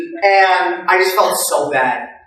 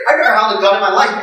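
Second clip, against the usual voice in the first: ordinary talk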